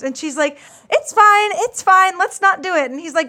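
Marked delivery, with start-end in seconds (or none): 0.9-2.9 higher, louder imitation of her saying it's fine; hammed-up nervous brush-off; mock flustered brush-off. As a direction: toss it off in a high, flustered voice. She brushes off the kiss.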